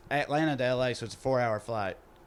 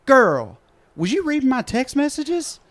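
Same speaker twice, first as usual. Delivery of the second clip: indignant; 11 semitones higher, 9 dB louder than usual. Retold or imitated. Lady confronts woman behind her.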